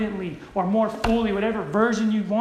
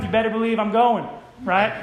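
ordinary delivery

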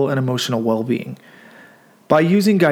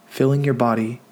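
guided meditation. Acted. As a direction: gently guide listeners into stillness, leaving room to breathe.

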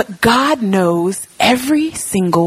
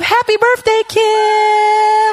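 excited birthday call; 13 semitones higher, much slower than usual. Real, not acted. Calling out birthday wishes to Kim, high and excited. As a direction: cheer it out to her across the room.

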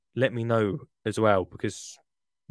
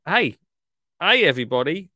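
excited greeting; 6 semitones higher, 7 dB louder than usual. Real, not acted. Excited announcement to listeners.

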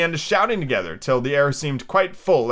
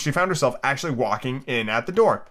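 ordinary delivery

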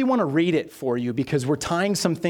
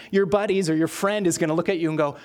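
normal talking voice

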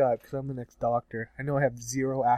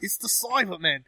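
higher, hammed-up Cockney echo; mock Cockney; 6 semitones higher, 3 dB quieter than usual. Second host echoing the fake Doctor, mock Cockney. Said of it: "hardcore Cockney".

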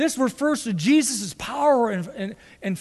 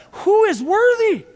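loud, high, slow proclamation; proclaimed question; 8 semitones higher, 5 dB louder than usual. Acted. Preacher proclaims the question, then echoes it.

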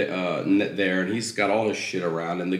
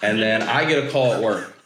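ordinary talk, nothing marked

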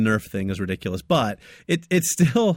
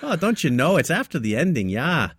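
put-on North Dakota accent; 6 semitones higher, 3 dB louder than usual. Host continuing his mock North Dakota greeting. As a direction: play up the "don't you know" North Dakota lilt. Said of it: "Like I'm from North Dakota now".

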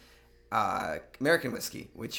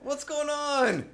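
baffled; 5 dB louder, much slower than usual. Retold or imitated. Playing the bartender.

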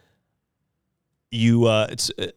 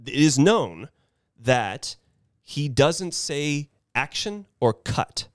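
ordinary talk

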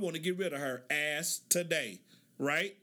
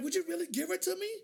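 eager surprise; 9 semitones higher, faster than usual. Retold or imitated. Man offered a free dog.

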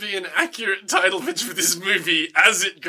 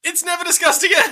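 high, raised-pitch outburst; mock exasperation; 11 semitones higher, 4 dB louder than usual. Host answers his own question on the plot hole, mock exasperated. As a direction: throw it out as an exasperated punchline.

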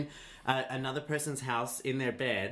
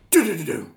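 loud, higher burst of "do-do-do"; imitated alarm noise; 5 semitones higher, 12 dB louder, faster than usual. Comedian sounding the alarm in her head, loud imitated noise. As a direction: fire off a sudden mental alarm. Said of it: "instantly it's like".

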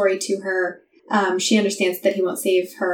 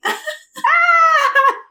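high sobbing laugh; a tearful burst of laughter, very high; laugh-cry; 5 dB louder than usual. Real, not acted. Reader breaking into a sob-laugh after the line. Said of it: "I can't handle this".

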